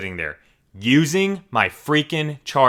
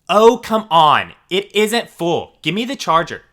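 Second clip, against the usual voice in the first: whiny demand; 8 semitones higher, 6 dB louder than usual. Retold or imitated. Entitled mom demanding the charger.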